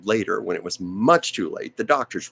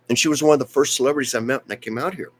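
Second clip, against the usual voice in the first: normal talking voice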